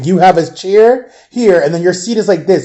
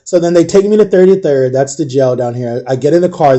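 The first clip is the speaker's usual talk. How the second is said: usual speaking voice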